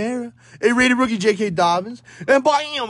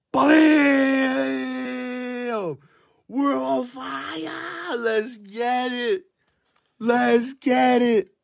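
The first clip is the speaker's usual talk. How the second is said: excited; 6 semitones higher, much slower than usual. Real, not acted. Still celebrating the hit.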